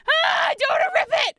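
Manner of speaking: high, loud wail; panicked, strained shout; distressed yell. Real, not acted. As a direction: cry out over nearly ruining it. Afraid of tearing the box.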